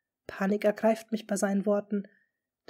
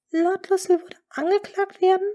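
high, loud shocked disbelief; shocked question; 11 semitones higher, 8 dB louder than usual. Acted. Lady Rochford to the duke, shocked and raised. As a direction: gasp it out in alarm.

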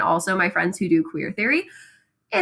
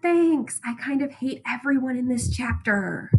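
mock polite disdain; 2 semitones higher, 5 dB quieter than usual. Voicing Nick's disdain on meeting Myrtle.

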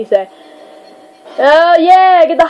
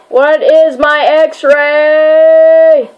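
drawn-out question; 4 semitones higher, 3 dB louder, much slower than usual. Real, not acted. Searching for the move.

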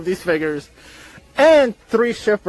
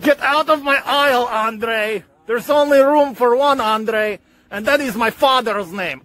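hammed-up Russian accent, booming villain boasts; Nikolai Volkov; 2 semitones higher than usual. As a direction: stake a claim as a mock Russian villain, with evil laughs. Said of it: "I am Nikolai Volkov".

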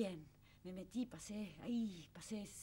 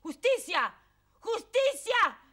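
rising shout, much higher and louder each time; gritando justicia; 9 semitones higher, 11 dB louder, slower than usual. Retold or imitated. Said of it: "Empecé a gritar". (grito de protesta cada vez más fuerte)

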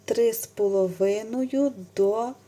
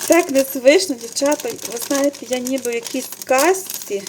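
much louder, delighted gush; thrilled; 3 semitones higher, 10 dB louder than usual. Real, not acted. Vlogger telling viewers she feels in a fairy tale, thrilled. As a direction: burst out in wonder. Overwhelmed by gift.

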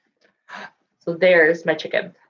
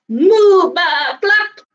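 animal noises; 9 semitones higher, 4 dB louder, much slower than usual. Acted. Animals at the bed.

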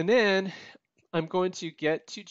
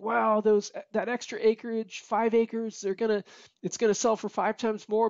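wide-eyed, higher-pitched hopeful guess; excited wow; 5 semitones higher than usual. Retold or imitated. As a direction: gush like you expect a big payday. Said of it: "and then you're like".

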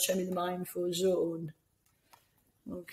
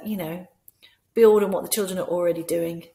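ordinary delivery

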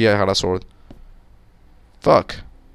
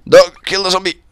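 higher, urgent order; raised-pitch command; calling out a command; 7 semitones higher, 3 dB louder than usual. Real, not acted. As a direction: send the dog in to attack. Calling a command to game dog.

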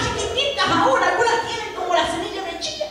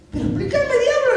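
lightly acted higher-pitched demand; insistent pastor; 7 semitones higher than usual. Pastor pleads with the devil to explain his crying, lightly acted insistence. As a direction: press for an explanation, pitch climbing. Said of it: "le dice el pastor".

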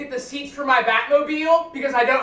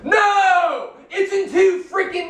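defiant shout; 4 semitones higher, slower than usual. Real, not acted. Son shouting back at his dad. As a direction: yell the no back at him. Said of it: "You're yelling again".

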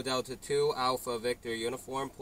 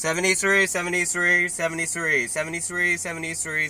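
calling out loud into the mic; loud radio call; 4 semitones higher, 6 dB louder, faster than usual. Real, not acted. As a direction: call it out over the air.

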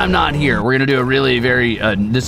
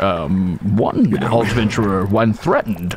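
nature documentary; 4 semitones lower than usual. Pretend. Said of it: "this is a documentary".

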